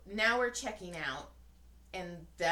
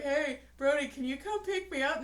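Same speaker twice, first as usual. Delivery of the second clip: lightly voiced mock older brother, higher; 7 semitones higher, 7 dB louder than usual. Son mimicking older brother calling, mock whine. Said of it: "he's gonna be like".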